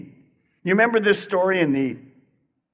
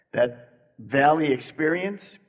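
ordinary delivery

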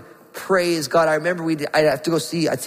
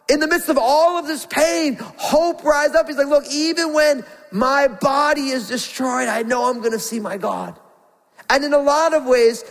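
high, urgent preacher's build; fervent preaching; 6 semitones higher than usual. Acted. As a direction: lift the congregation with rising hope. Preaching at full force.

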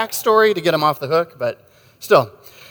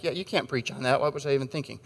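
muttered self-doubt; 9 dB quieter than usual. Retold or imitated. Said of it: "I think".

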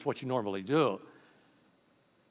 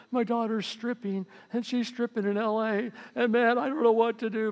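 distressed mother; 10 semitones higher, 3 dB louder than usual. Retold or imitated. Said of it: "She's crying, going".

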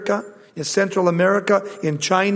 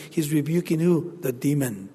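ordinary delivery

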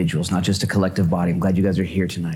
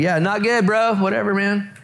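higher, casual bro voice; surfer-dude Peter; 12 semitones higher than usual. Acted. Peter answering Jesus like a casual bro, higher. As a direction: shrug it off like a bored dude. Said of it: "Peter looks around and is like".

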